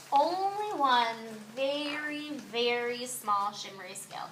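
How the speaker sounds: soft, slow wavering inner voice; quiet thought. A narrator's reading. Rainbow fish privately considering giving one scale, soft and slow. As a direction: weigh it up softly to yourself. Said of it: "he thought".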